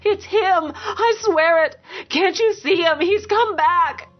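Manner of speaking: very high, desperate cry; screamed. A narrator's reading. Beaten woman insisting her dead husband is back, screaming. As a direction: scream it high and frantic, without much added volume. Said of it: "She screamed".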